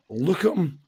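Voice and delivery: ordinary delivery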